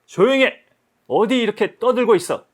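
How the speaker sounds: loud, high scolding of the crowd. Acted. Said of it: "야단치고 꾸지람을". (높고 큰 소리로 꾸짖기)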